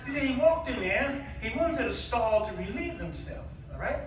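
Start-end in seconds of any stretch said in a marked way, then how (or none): none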